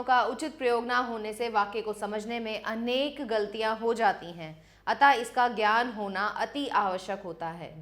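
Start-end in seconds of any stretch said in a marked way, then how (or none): none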